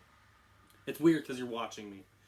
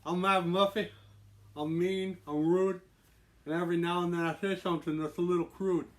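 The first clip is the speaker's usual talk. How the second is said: loud, higher-pitched Irish gangster boast; attempted Irish mob boss; 6 semitones higher, 7 dB louder than usual. Host introducing his gangster character, amateur Irish mob boss. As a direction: introduce yourself as a cocky Irish mobster. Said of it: "like, a, an Irish mob boss".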